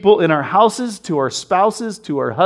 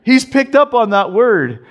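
ordinary talk

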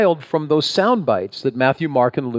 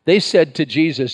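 ordinary talk

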